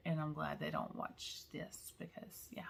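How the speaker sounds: a conspiratorial whispered aside. Real, not acted. Joking that her coworkers don't watch, whispered. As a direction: whisper it so they won't hear.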